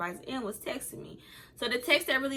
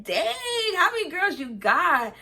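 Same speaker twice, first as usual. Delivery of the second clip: mocking sass; 9 semitones higher, 5 dB louder, slower than usual. Mocking boyfriend about other girls.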